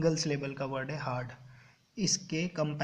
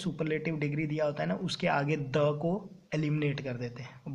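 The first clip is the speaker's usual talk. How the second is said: ordinary talk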